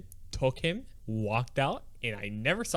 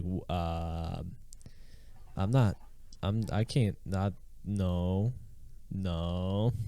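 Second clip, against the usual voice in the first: low, halting mock refusal; hesitant refusal; 5 semitones lower, much slower than usual. Customer refusing to eat the found nugget, hesitant. Retells being baffled, refusing.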